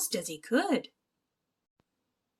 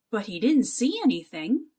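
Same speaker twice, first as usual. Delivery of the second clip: ordinary delivery, nothing marked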